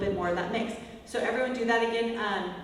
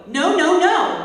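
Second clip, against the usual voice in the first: higher, louder scolding no; mock mad at the dog; 7 semitones higher, 11 dB louder, much slower than usual. Shown as a demonstration. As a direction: shake your finger and bark no. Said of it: "like you're mad at the dog".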